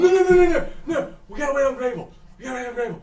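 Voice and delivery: high, loud frantic no; high nervous protest; panicked refusal. Acted. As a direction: refuse in a rush of fear.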